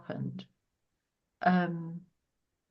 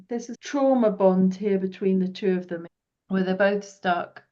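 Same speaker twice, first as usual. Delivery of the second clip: normal talking voice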